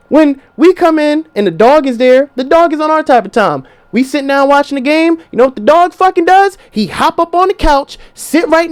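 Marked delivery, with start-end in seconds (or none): none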